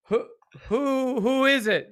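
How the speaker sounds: high, loud stammered call; startled shout. Acted. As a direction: shout it out, stumbling on "who". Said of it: "He just shouts out".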